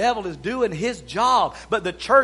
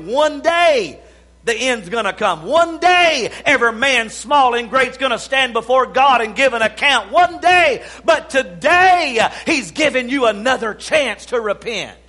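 louder and higher with each one day; climactic preaching; 6 semitones higher, 9 dB louder than usual. Acted.

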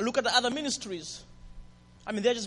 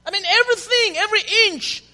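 high, loud burst of preaching; preaching shout; 9 semitones higher, 9 dB louder than usual. Real, not acted. Preacher shouting to the congregation. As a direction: lift it into a full-voiced cry.

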